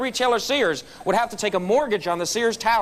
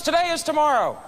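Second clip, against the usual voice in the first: put-on higher, proud kid announcement; triumphant little boy; 7 semitones higher than usual. Son triumphantly telling dad the day has come, higher kid voice. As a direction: deliver it like a child's big discovery.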